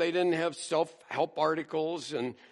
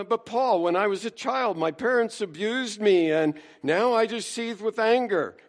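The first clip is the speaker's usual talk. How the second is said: higher, louder pleading whine; mock-whiny, begging Paul to understand; hammed-up grievance; whiny complainer; 7 semitones higher, 6 dB louder than usual.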